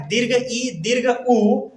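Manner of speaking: ordinary talk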